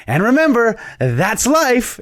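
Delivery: high, loud mock TV sign-off; theatrical sign-off. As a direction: act out a big showman's farewell to camera. Acting Joker's triumphant sign-off to camera.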